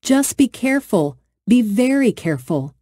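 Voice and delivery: big sister warning Jessie from far ahead; warning call. A narrator's reading. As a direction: call a caution back down the path. Emma calling back warning.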